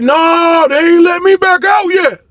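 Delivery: high, drawn-out mock shout; imitated holler back. Brown answers from his locked cell, high mock holler. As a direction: stretch it out as if calling down a hallway.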